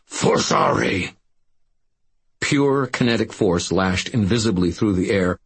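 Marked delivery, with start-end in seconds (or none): 0.1-1.1 low, slow snarled command word; snarled spell. A narrator's reading. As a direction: growl the spell out, drawn long. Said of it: "and snarled".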